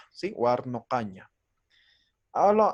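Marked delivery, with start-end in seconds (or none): 0.4-1.3 "Warnokaña" repeated lower, open o, falling; careful model pronunciation. Shown as a demonstration. Teacher repeating it for students, "Warnokaña" said carefully and lower. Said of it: "vamos a decir".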